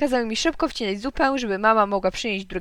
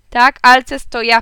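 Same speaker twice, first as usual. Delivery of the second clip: loud, raised phone voice; calling back; 3 semitones higher, 11 dB louder, slower than usual. A narrator's reading. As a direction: call back so the friend hears. Shouting back over the phone. (głośna odpowiedź do telefonu)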